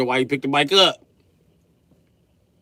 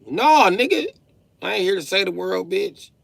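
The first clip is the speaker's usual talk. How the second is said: retort; 10 semitones higher, 3 dB louder than usual. Retold or imitated. Snapping back at friend.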